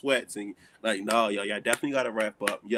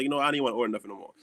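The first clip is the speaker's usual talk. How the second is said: fed-up rush; much faster than usual. Retold or imitated. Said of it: "And then I be like".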